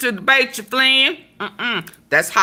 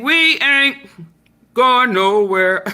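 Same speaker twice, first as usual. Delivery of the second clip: lightly voiced [name]; 4 semitones higher than usual. Rapper boasting, hint of [name] voice. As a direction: brag it like [name], barely changing voice. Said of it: "[name] said".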